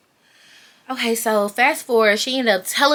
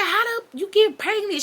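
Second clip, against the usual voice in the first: much higher, incredulous mom voice; mock maternal disbelief; 12 semitones higher than usual. Mom hearing her daughter is pregnant, high-pitched disbelief. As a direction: imitate a stunned mother demanding answers.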